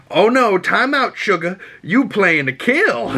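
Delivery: high, sassy Southern belle voice. Retold or imitated. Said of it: "Rogue comes out of nowhere and pins him down and goes".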